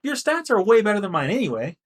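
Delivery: mock outrage at unfair odds; indignant protest. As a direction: protest as if cheated in a match. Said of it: "I'm like".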